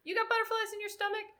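high, quick sing-out question; calling to stranger. Retold or imitated. Coach asking a random racer about nerves, casual high call-out. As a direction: toss it out high and fast.

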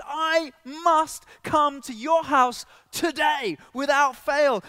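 high, loud, emphatic and drawn out; urgent insistence. Acted. Jesus telling Zacchaeus he must visit his house, urgent. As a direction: insist on it with full-voiced urgency. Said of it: "There's this urgency".

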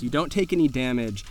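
normal talking voice

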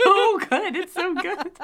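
high, squealing laughter through the words; helpless laughter. Real, not acted. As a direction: gush while cracking up. Cracking up over the proposal.

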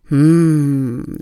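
contented mm with a nod; easy hum. Shown as a demonstration. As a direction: hum as if agreeing. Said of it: "diesem Brummton".

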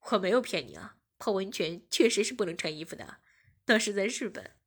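deep-ish voiced boyfriend protesting. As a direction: insist you did not lie. (低沉辩解的男友声)

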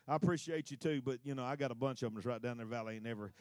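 God chuckling down at a boastful prophet; God amused. Acted. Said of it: "God just sort of laughs at him and says".

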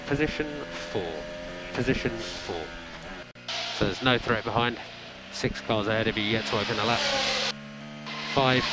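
normal talking voice

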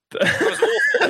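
squealing through laughter; can barely get it out for laughing. Real, not acted. Host reacting to the awful sketch, laughing.

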